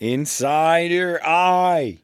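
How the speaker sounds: high, very slow Boston vowels; Boston accent. Pretend. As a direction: stretch it out Boston style. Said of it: "they add R's".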